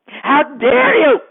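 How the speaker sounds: outraged repeat. Acted. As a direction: rise up in offended fury. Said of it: "gargoyle indignant, louder second take".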